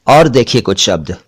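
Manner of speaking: ordinary talk, nothing marked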